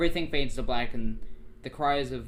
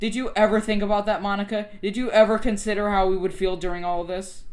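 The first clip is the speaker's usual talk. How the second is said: high, loud accusing questions; acted emotional confrontation; upset reproach; 8 semitones higher, 6 dB louder than usual. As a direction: demand it, hurt and insistent. Acts character confronting Monica.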